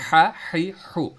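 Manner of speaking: हा, ही, हु pushed hard from the back of the throat; strained guttural ha sounds; forceful throaty ha. Shown as a demonstration. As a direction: push the breath out hard on हा ही हु. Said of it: "आवाज़ को सख्ती के साथ निकाल रहा हूँ".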